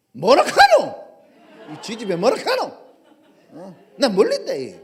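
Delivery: Peter snapping back in Gyeongsang dialect, laughing. Acted. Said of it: "베드로가 사투리를 쓰는 거야". (경상도 사투리로 버럭 부인하기)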